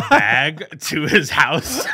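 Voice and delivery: loud, high and breaking into laughter; laughing while telling. Real, not acted. Friend telling podcast mates about a clown prank, laughing. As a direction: tell it while cracking up.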